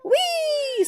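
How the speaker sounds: put-on very high, loud girlish whee; long high delighted shriek; gleeful squeal. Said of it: "squealed a young girl".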